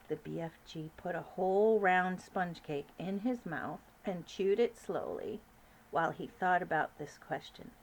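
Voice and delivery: ordinary delivery, nothing marked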